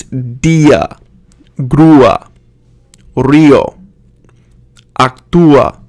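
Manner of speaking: dia, grúa, río with the accented vowel stressed; two-syllable vowels. Shown as a demonstration. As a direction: split Raúl as ra-OOL, grúa as GROO-a. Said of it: "needs to be two syllables".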